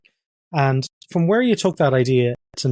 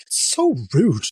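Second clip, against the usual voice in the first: higher-pitched imitation of outraged onlookers; hammed-up disapproval in a raised voice; mock indignation; 7 semitones higher than usual. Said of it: "Everyone would be like".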